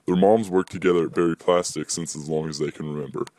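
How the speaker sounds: plain reading voice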